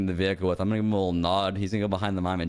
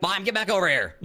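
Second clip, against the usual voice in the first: calling out; 8 semitones higher than usual. Acted. Cop calls mime back.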